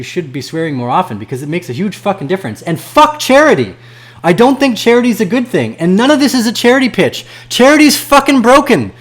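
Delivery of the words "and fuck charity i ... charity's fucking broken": angry shout. Real, not acted. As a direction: bellow it at the haters. Ranting about charity.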